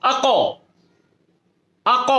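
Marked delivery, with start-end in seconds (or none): 0.0-0.6 আক with the final k bounced back, almost doubled; echoing bounce on the k. Shown as a demonstration. Teacher modeling আক with a bouncing k for students. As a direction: say আক so the k echoes like a second syllable. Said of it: "এরকম উচ্চারণ করেন".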